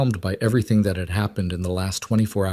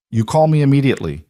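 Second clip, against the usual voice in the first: higher, louder, fast insistence; insistent command; 6 semitones higher, 5 dB louder, faster than usual. Acted. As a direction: press it as an order. Orders her insistently.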